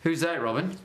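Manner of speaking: put-on Australian accent, querying; mimicked Australian listeners. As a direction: imitate puzzled Australians in their accent. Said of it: "I try it out and they go".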